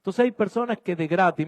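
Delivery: ordinary talk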